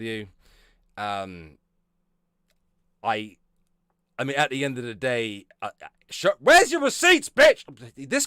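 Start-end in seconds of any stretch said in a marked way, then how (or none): 6.4-7.6 loud, high demand for receipts; outraged yell. Real, not acted. Ranting at the accuser on stream in an outraged yell. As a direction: shout it at her, much higher and louder.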